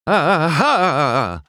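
high, loud juddering cry; jostled about. A narrator's reading. Mater rides the bumpy asphalt, jostled. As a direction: let the bumps shake the voice. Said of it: "as he was jostled back and forth".

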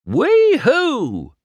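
high, loud joyful whoop; calling out. A narrator's reading. Mater whoops as he tries the new road, calling. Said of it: "Mater called as he drove".

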